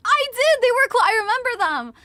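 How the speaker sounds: high, loud delighted burst; excited. Real, not acted. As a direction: gush about the gloves. Thrilled about gloves comment.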